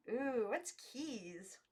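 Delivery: put-on slow childlike wonder; awed girls. A narrator's reading. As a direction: draw it out wide-eyed. Girls ask about keys.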